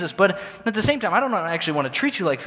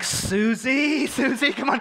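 pleading; 7 semitones higher, slower than usual. Retold or imitated. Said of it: "I'm like".

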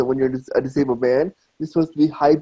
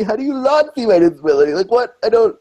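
higher-pitched indignant disbelief; offended; 6 semitones higher, 3 dB louder, faster than usual. Retold or imitated. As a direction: retort in rising, exasperated disbelief. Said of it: "so offended 'cause I'm like".

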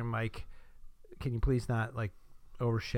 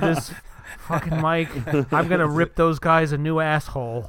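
angry rant; 4 semitones higher, 9 dB louder than usual. Pretend. Mock rant about the broken mic.

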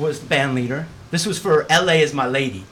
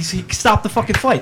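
ordinary talk

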